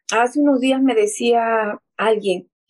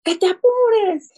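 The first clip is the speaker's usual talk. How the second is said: much higher, drawn-out yell; mother's yell retold; 11 semitones higher, slower than usual. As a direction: holler at the child to hurry, slowly. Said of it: "la mamá gritó". (grito de madre imitado, agudo y alargado)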